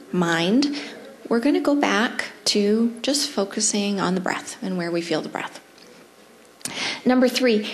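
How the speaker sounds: usual speaking voice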